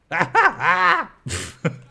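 high, loud cackling laugh; big cackle. Acted. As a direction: throw your head back and cackle. Performing medic voice lines.

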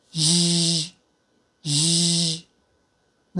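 ジー as a slow, sustained buzzing hush; voiced fricative. Shown as a demonstration. Teacher drills number six after its voiceless partner, ジー long and buzzed. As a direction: add voice to a hushing sound, held long as ジー. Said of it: "二つ目の黒い方は有声音で".

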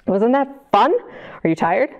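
ordinary delivery

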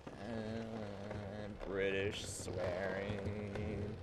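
slow, low, drawn-out mock reply; lightly put-on sluggish voice, softly; silly put-on voice. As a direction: stretch it out in a goofy low voice. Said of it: "I don't know what voice this is".

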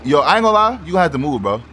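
ordinary talk

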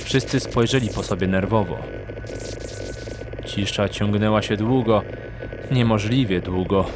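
ordinary talk

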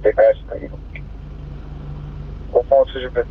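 crackly two-way radio transmission; squelchy handheld radio; police radio. Real, not acted. Unit over radio.

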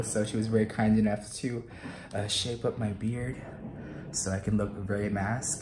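ordinary talk

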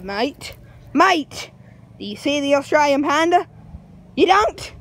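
hammed-up Aussie accent; broad mock-Australian voice. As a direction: play an exaggerated Australian guide. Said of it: "words in my Australian accent".

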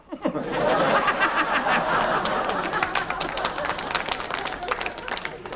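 high wheezing giggle; high laugh. Real, not acted. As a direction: crack up at the gag. Laughs at own mirror joke.

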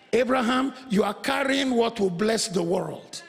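usual speaking voice

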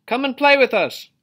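high, loud friendly call. A narrator's reading. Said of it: "they would call".

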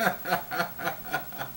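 quiet real chuckle; soft laugh. Real, not acted. Host chuckling at his own joke. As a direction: laugh at your own punchline.

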